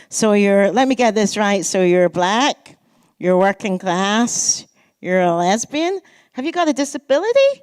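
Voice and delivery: lightly voiced Canadian professor, slowed; Canadian professor quizzing a student, in a light mock Canadian accent; fake Canadian accent. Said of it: "forgive my kind of fake Canadian accent".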